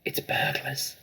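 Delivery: urgent frightened hiss. Acted. Mother warning the family of burglars in a hiss. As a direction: breathe it out in alarm. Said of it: "hissed the mother".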